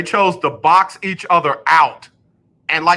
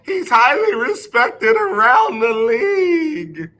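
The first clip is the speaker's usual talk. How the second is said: high, slow, dripping with sarcasm; sarcastic; 9 semitones higher, slower than usual. Shown as a demonstration. Mocking the league's praise of Wentz, high and sarcastic. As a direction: say it so nobody thinks you mean it. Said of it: "You gotta say it like that".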